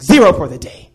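loud, curt mock verdict; mad teacher. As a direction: imitate a teacher angrily handing down a penalty. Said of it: "And she was mad".

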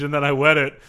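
ordinary delivery

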